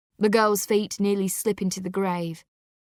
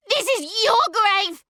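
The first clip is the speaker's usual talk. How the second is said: very high, loud furious scream; girl screaming at her uncle while pushing him; 13 semitones higher, 6 dB louder than usual. Acted. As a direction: scream it as you shove him. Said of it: "Molly screams".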